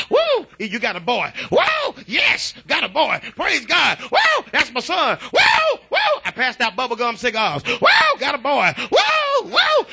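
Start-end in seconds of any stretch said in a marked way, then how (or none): 0.1-6.2 high whoops between proud announcements; squealed "woo", then jubilant news; retold joyful shouting. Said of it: "I shouted". 7.6-9.9 very high celebratory whoops; re-enacted jubilant squeals of "woo"; retold joyful shouting. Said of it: "I was shouting".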